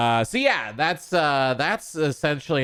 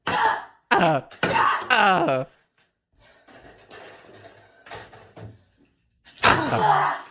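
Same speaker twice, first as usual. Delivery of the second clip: fake crying groans; 9 semitones higher, much slower than usual. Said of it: "And action".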